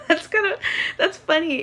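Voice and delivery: high-pitched, laughing through the words; amused, voice jumping up with laughter. Real, not acted. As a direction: really crack up while talking. Cracks up at the pickup line.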